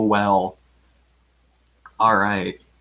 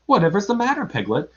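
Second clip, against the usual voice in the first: lightly voiced concerned boy, lifted at the start; Christopher Robin; 8 semitones higher, faster than usual. A narrator's reading. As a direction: ask with mild, high-pitched surprise. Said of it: "said Christopher Robin".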